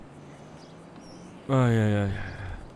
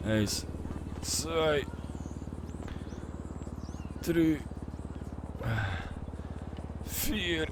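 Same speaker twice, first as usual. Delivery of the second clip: doing sit ups; 4 semitones higher, 10 dB quieter, much slower than usual. Real, not acted. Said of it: "ich mach auch noch schnell ein paar Sit-Ups".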